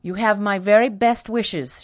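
cold formal wishes. Acted. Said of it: "with a low curtsy".

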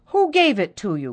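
jealous sister crying out the question. Acted. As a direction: fire the question at her. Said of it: "cried the other".

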